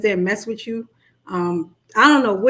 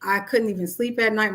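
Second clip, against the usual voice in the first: ordinary delivery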